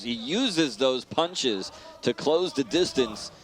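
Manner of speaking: ordinary delivery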